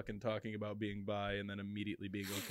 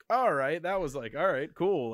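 startled okay; 5 semitones higher, 8 dB louder than usual. Retold or imitated. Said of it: "I was like".

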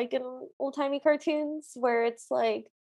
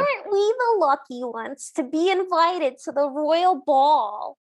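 hammed-up Brooklyn accent, pitched higher; 3 semitones higher, 3 dB louder than usual. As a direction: play a cartoon stepsister in full Brooklynese. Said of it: "You're so good at that".

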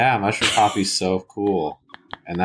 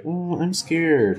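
mock scared; 10 semitones higher, much slower than usual. Mocking friend's timid reply.

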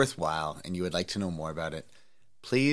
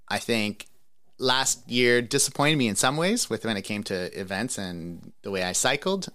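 ordinary talk

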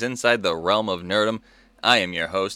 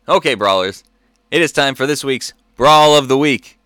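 announcer; 4 semitones higher, 9 dB louder than usual. Acted. Segment announcement.

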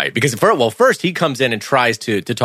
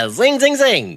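mock zing cry; slower than usual. Teasing after a burn.

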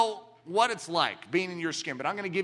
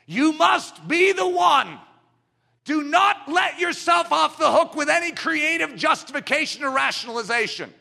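preaching at full force; 10 semitones higher, 9 dB louder than usual. Real, not acted. Preacher demanding each listener respond, shouting high. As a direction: shout it at the room.

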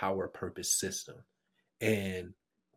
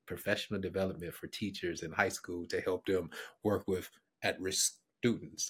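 usual speaking voice

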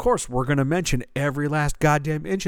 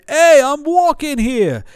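high, loud New York cabbie shout; hammed-up New York street yell; 12 semitones higher, 9 dB louder, slower than usual. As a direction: bark it like a stock New Yorker. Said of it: "one step away from screaming".

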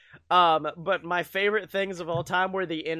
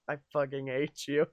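retold muttered hate; 6 semitones lower, 7 dB quieter than usual. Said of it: "he'll look at me and be like".

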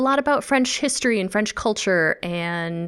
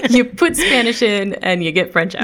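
laughing; 5 semitones higher, 5 dB louder than usual. Real, not acted. Joking about French coming out.

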